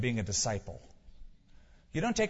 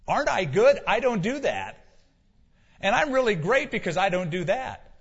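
loud, higher, smug bragging; mock self-righteous boast; 6 semitones higher, 6 dB louder than usual. Preacher mocking people proud of what they avoid, smug and loud. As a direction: puff up like a proud churchgoer.

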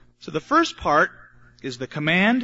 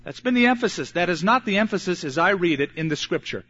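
normal talking voice

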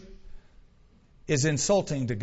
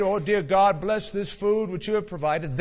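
raised, lightly put-on pious voice; mock pious prayer; 4 semitones higher than usual. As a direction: pray with a show of piety. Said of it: "piously bowed his head and prayed".

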